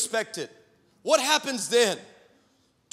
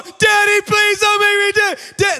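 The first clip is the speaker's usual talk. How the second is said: high, loud mock-panicked wail; crying plea; 10 semitones higher, 9 dB louder than usual. Daughter pleading as countdown runs, mock crying. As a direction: beg frantically like a little girl. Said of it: "a crying seven-old girl".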